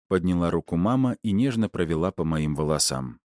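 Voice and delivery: usual speaking voice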